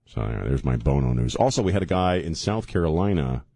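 ordinary delivery

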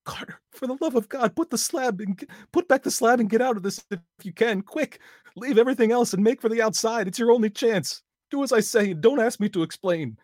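very high, loud frantic shouting; wild consternation. A narrator's reading. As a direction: scream a desperate warning. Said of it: "in a pitch of wilder consternation".

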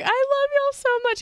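hammed-up drunk and teary; drunk crying fan. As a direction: play a weepy drunk fan. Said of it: "she was like".

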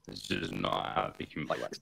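breathy, disbelieving under-the-breath aside; deadpan whisper. Retold or imitated. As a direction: mutter it in flat disbelief. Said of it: "And you're like".

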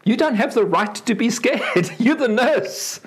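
mock outrage that melts into laughter; incredulous patient. Patient scolds the scared nurse, mock indignant and laughing. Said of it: "You're going".

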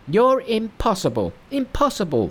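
high monkey voice, mild mock fury; exasperated monkey, impossible twice; Monkey thundering. Monkey rages at his fearful master, high mock fury. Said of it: "thundered Monkey".

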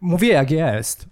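slow, emphatic catchphrase parody; Max Kolanko. Acted. Co-host capping host's "Ja mówię", mock-pundit impression. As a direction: draw it out like a self-assured TV pundit. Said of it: "Max Kolanko".

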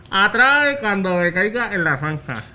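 muddied lip sounds; garbled mouth-game speech. Real, not acted. Mouth game, partner guesses distorted phrase.

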